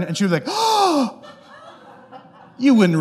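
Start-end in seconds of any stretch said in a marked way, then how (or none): 0.5-1.2 high, drawn-out shocked gasp; mock offended gasp. Mom called Karen by her child, gasping offended. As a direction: gasp as if deeply insulted.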